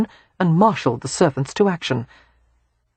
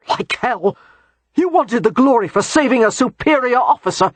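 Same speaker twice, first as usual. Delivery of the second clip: angry accusation; 7 semitones higher than usual. Acted. Bennett accuses Christopher.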